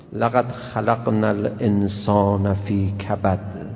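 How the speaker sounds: slow, measured Quranic recitation. Acted. Preacher reciting the verse to his listeners, slow and solemn. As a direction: intone the verse slowly and reverently.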